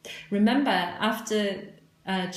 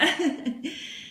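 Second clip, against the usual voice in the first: light laugh; 7 semitones higher than usual. Real, not acted. Laughs at her own doubt.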